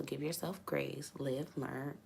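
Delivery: unvoiced, soft reading; mumble-whisper the odd phrase. Real, not acted. Reading a confusing viewer comment, whispered.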